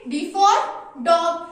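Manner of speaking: loud, punchy teacher call-out; emphatic naming. Shown as a demonstration. Teacher showing pupils the first picture, loud and emphatic. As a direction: announce the word loudly for small children. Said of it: "तो डॉग की spelling".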